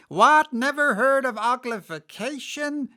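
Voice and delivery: high, loud shocked Griffin; astonished. A narrator's reading. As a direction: shriek it out in disbelief. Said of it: "lifted up both its paws in surprise".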